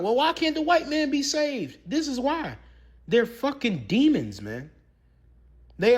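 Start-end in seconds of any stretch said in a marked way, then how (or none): 0.0-1.8 mocking imitation. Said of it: "y'all be like".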